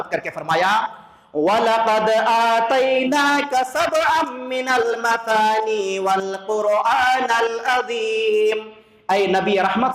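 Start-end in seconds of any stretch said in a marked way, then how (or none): 1.4-8.6 very slow, drawn-out Quranic chant; preacher chants the verse from Surat al-Hijr; Quran recitation. Acted. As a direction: chant the verse slowly and melodically.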